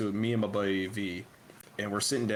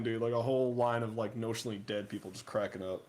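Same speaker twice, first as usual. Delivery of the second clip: ordinary delivery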